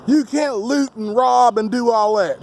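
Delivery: slow, dismissive mock critic. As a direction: play the critic who brushes it off, slowly. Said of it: "You'll probably try to flip it and say".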